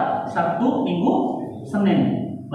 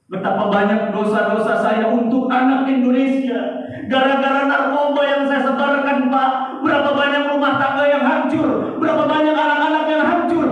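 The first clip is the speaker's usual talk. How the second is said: louder, pleading cry to the officer; a condemned man begging, his voice raised; anguished plea; 5 semitones higher, 6 dB louder than usual. Retold or imitated. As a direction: beg with rising anguish, slowing at the end. Condemned man's last plea.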